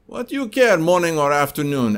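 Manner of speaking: high, loud, exasperated Russian salesman; irritated Russian accent. Retold or imitated. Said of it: "if you didn't like my Russian accent".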